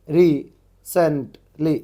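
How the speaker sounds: Recently very slow and loud, in separate syllables; broken into syllables. Shown as a demonstration. Teacher decodes chat-like spelling, recently syllable by syllable. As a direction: punch out each syllable of recently. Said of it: "जैसे कि अपन WhatsApp में chatting करते".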